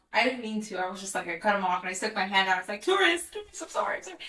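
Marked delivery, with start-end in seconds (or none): 2.8-4.2 high-pitched mock-flustered apology; retold panicked apology. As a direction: call out sheepishly to an offended driver. Calling apology to driver, retold.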